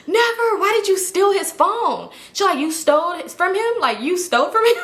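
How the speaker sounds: scandalized accusation; shocked gasp. Retold or imitated. Leslie gasping in shock.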